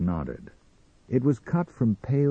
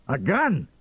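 high, startled exclamation; alarmed cry; 9 semitones higher, 3 dB louder than usual. Acted. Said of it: "cried Sam Parkhill".

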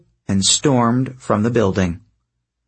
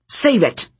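high, sharp snap; snapping at the goddess to shut her up; snapped; 10 semitones higher than usual. Acted. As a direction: cut her off hard. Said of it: "Thalia snapped".

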